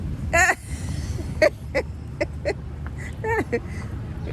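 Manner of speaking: a long, high burst of laughter. Real, not acted. Laughing at son's accent attempt.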